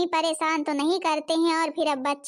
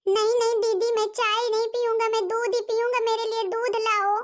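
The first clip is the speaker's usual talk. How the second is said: put-on high-pitched child, insisting; a small boy demanding from his sister; little boy refusing; 6 semitones higher than usual. (ज़िद करते छोटे बच्चे की आवाज़)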